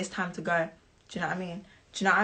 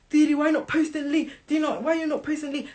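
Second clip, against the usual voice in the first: high, pestering voice of the friends; nagging friends; 7 semitones higher than usual. Retold or imitated. Friends pestering her to post on the birthday, high and nagging. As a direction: whine it like friends who keep asking. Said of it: "everyone kept messaging me".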